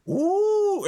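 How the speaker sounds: squealed ooh, way up high; a goofy troll's squeaky ooh. Acted. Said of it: "the second one goes".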